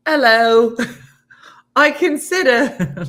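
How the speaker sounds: high, slow, hammed-up English accent with laughs; laughing through a put-on English accent; mock English accent. Host showing off her English accent, laughing. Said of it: "all of my English accents sound like".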